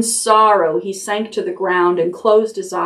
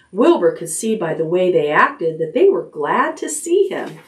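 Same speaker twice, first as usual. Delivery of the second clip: usual speaking voice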